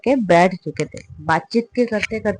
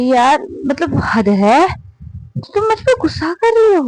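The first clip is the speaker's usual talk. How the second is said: put-on much higher, drunken indignant squeal; drunk outrage; 8 semitones higher than usual. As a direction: squeal it out, offended and tipsy. Drunk girl outraged at partner.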